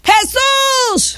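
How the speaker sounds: high, drawn-out call for help; acted high calling out. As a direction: cry the name out high, from afar. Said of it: "alzaron la voz diciendo".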